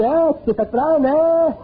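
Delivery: the mind's voice mocking him after the fight; taunting little voice. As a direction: gloat like a sly inner voice. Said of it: "elle dit".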